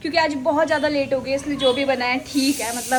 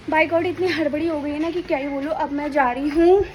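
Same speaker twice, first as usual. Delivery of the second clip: ordinary delivery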